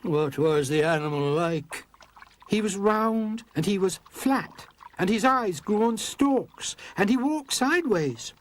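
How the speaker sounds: put-on high, eager child voice; piping little daughter telling what she saw; little girl. A narrator's reading. Daughter tells the magician about the crab, high child voice.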